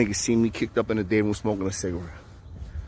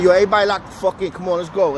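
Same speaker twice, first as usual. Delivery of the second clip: loud, high, quick order; commanding call; 8 semitones higher, 4 dB louder than usual. Retold or imitated. Inmate telling everybody to lock in, loud and commanding. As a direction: call out to rally the whole house.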